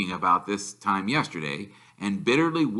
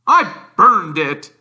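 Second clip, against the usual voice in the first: gruff uncle, pitched up and louder; a blustering uncle voice; Uncle Vernon; 10 semitones higher, 10 dB louder, slower than usual. A narrator's reading.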